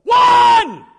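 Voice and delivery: huge, high gym holler; full-out yell. Shown as a demonstration. Coach showing his point guard how loud, full-out yell. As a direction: bellow the play call across a noisy gym. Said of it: "But you gotta be loud".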